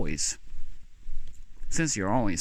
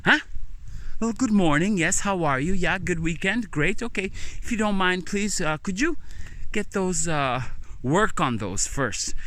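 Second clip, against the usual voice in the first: sarcastic politeness; 8 semitones higher than usual. Acted. Mocking the boss's rudeness.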